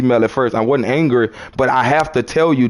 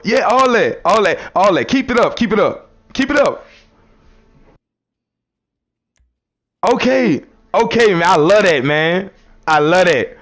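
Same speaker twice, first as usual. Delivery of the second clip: hyped encouragement; 3 semitones higher than usual. Real, not acted. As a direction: pump them up, keep it going. Cheering on a listener.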